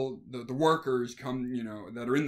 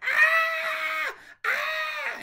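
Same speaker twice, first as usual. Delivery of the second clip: put-on shrill high-pitched girlish shriek, loud; little girl scream; 6 dB louder than usual. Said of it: "like a little girl".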